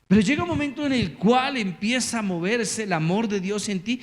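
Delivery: usual speaking voice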